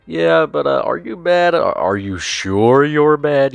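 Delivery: high-pitched, put-on nagging; exaggerated insistent questioning, hammed up; mock repetitive pestering. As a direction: keep pressing in a high mocking voice, slowing at the end. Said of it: "being very repetitive".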